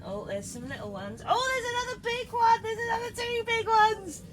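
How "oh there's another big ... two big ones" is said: excited. Real, not acted. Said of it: "this is exciting".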